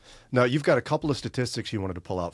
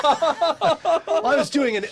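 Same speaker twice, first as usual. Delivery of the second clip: talking through laughter; laughing; 6 dB louder, much slower than usual. Real, not acted. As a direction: laugh while you speak. Laughing at own bad accent.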